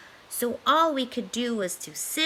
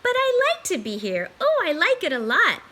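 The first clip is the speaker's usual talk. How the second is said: higher, louder playful cat; cheeky cat; 8 semitones higher, 6 dB louder than usual. Acted. Cat refusing to leave, cheeky and loud. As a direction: tease the fish merrily. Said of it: "said the Cat in the Hat".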